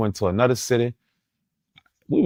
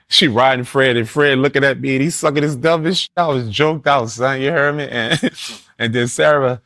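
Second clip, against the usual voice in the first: laughing through the words, higher pitch; amused telling; 4 semitones higher than usual. Real, not acted. Man recalls walking in on two friends, amused and laughing. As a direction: crack up while telling it.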